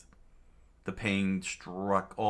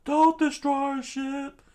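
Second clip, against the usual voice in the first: very high falsetto crew shriek; panicked cartoon screech, far above own pitch; screaming; 5 dB louder than usual. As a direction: squeal it in alarm, growing louder. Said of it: "everyone screamed out".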